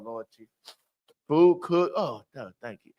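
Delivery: ordinary delivery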